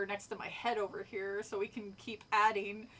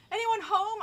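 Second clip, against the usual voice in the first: higher, louder call into the house; shouted call; 8 semitones higher, 6 dB louder than usual. A narrator's reading. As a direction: call out to see who is home. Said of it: "I shouted into the house".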